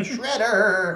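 whiny villain calling for help. Retold or imitated. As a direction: whine the name out. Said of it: "like, whiny voice".

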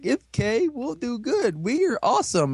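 high, goofy overconfident voice; mock confident heroes. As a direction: mimic naive cheerful heroes. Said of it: "they're like".

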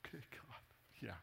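whispered under her breath; sly unvoiced whisper to God; mock whisper. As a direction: hush it like someone eavesdropping. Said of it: "she was just like".

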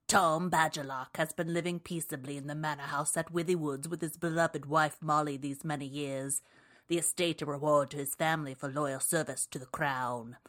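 theatrical blurb reading, lively and dark; dramatic audiobook voice. Pretend. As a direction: read it like an audiobook narrator. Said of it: "I did it in a little bit of a voice".

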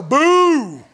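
loud jeering boo; mock boo. Funeral-goer jeering a eulogy, loud mock boo. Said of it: "they're not gonna go".

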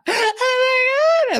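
very high, stretched-out oh my God; excited squeal. Retold or imitated. Girl squealing at the acceptance email, high and drawn out. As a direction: squeal it long like the best news ever.

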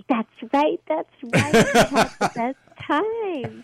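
high, loud, laughing delight; beaming as she recalls the day; delighted laughing agreement. Real, not acted. Guest's daughter meeting her fondly.

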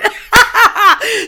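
loud, high cackling laugh; big laugh. Real, not acted. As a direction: burst out laughing at yourself. Laughs at own self-praise.

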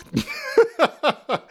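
very high amused laughter. Real, not acted. Teacher amused by drunk-or-high example, laughing. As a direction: giggle at your own outrageous questions.

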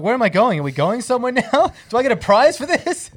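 high, loud, bewildered questions; mock confusion. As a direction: act baffled and excited. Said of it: "I was like".

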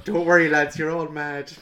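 mock reassurance to fans. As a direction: call out jokingly, drawn out and loud. Jokey mock shout to rowdy fans.

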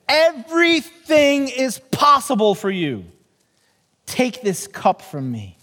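scripture reading. Acted. Reading Jesus' prayer in Mark.

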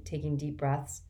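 ordinary delivery, nothing marked

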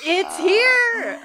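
high, loud theatrical announcement; hammed-up proclamation; mock-dramatic cry. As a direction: call it out as if doom has arrived. Mock-dramatic call after "of winter.

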